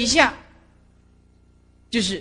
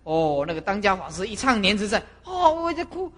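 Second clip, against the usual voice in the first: normal talking voice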